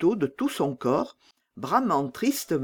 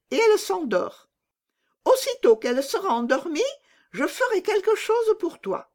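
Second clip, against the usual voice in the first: put-on much higher, conspiratorial bandit girl; scheming robber girl; 3 dB louder than usual. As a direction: plot an escape eagerly. Robber girl plots Gerda's escape.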